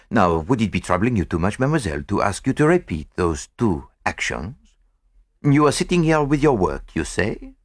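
lightly voiced French detective, very polite; courteous French-accented detective. A narrator's reading. Poirot asks the maid to re-enact, courteous.

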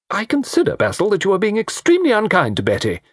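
mother rebuking son, high and reproachful; high stern mother. A narrator's reading. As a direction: scold her son primly.

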